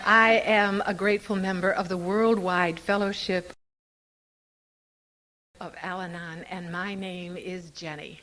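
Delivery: hushed serene greeting; serene Al-Anon voice. Shown as a demonstration. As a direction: settle it down like leading beginners. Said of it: "That serene voice".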